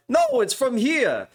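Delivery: high, slow, exaggerated insistence; mock New Yorker. New York hot dog fan insisting it's theirs, mock high voice. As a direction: mimic a New Yorker claiming it. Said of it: "they'll be like".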